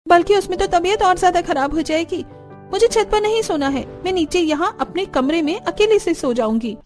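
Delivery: high-pitched, insistent refusal; defiant daughter-in-law. Acted. (ज़िद करती बहू का इनकार)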